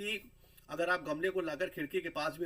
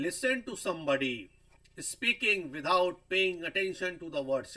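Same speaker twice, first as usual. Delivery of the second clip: slow, deliberate reading of a definition; slow dictation; 3 semitones higher, 5 dB louder, much slower than usual. Shown as a demonstration. Teacher reading the slide's definition to the class, slowly. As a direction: read it out word by word for students to copy.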